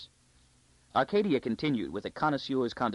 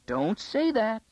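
high, alarmed plea; distressed; 11 semitones higher, 4 dB louder, slower than usual. A narrator's reading. Court lady begging the girl not to mock the curse, alarmed. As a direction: beg her not to say it. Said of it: "Kalia was distressed".

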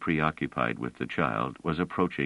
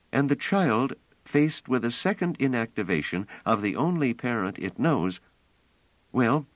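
higher-pitched, insistent story voice; earnest, raised-pitch character dialogue; earnest woman character, urgent; 7 semitones higher than usual. A narrator's reading.

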